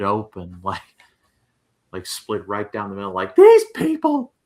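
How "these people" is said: high, loud, drawn-out cry; mock outburst. Joking about a friend's head splitting, mock high shout. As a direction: blurt it out in a hammed-up high shout.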